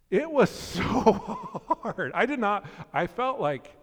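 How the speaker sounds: high, loud, slow laughing groan; admitting the prayer was hard, high laughing; laughing strain. Real, not acted. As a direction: stretch it out laughing. Hard to pray for slow driver.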